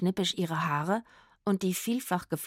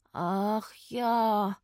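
long drawn-out wailing sigh; whining; 2 semitones higher than usual. A narrator's reading. Hungry Rufus is reminded there is no food, whining. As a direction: moan it in self-pity. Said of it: "jammerte Rufus".

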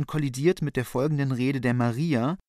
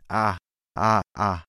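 rhythmic inarticulate ah; 4 semitones lower, slower than usual. Acted. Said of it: "rhythmisches, unartikuliertes".

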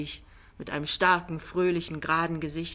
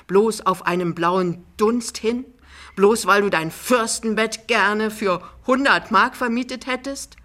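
confronting her in short phrases, growing louder; halting but distinct; 3 semitones higher, 7 dB louder than usual. A narrator's reading. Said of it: "sagt sehr weiß und ein wenig stockend, aber sehr deutlich". (stockend, sehr deutlich, zunehmend empört)

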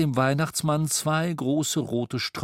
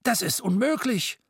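much higher, aghast exclamation; 9 semitones higher than usual. A narrator's reading. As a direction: throw up your hands in disbelief. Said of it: "Mister Scrooge erschrak".

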